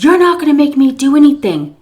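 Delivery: high, loud retort; defiant yelling. Retold or imitated. Miss C refusing daughter-in-law's orders, loud and defiant. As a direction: throw it back defiantly. Miss C defies her, retold.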